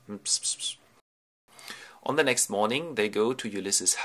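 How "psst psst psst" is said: voiceless psst-psst noises; imitated whispering. Ulysses sharing his plan with his son, mimed whispering. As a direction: mimic secret whispers.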